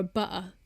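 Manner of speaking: butter with the T replaced by a throat catch; glottal stop. Shown as a demonstration. Explaining why people misunderstand the order, glottal-stopped butter. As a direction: say bu'er, British glottal stop. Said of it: "like, a glottal stop".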